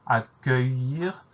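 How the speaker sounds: "Accueillir" said very slowly, each sound held; slow, careful enunciation of "Accueillir"; drawn-out dictation. Shown as a demonstration. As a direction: stretch "Accueillir" out for a spelling class. Teacher sounds out word for spelling.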